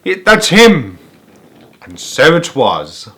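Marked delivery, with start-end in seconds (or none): 0.0-1.0 excited call. Acted. Crowd shouting at Wonka's arrival.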